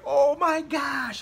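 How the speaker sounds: drawn-out, high retold dismay; freaking out. Realizing he missed a church booking, freaking out. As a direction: stretch it high in mock panic. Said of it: "I was, like, freaking out".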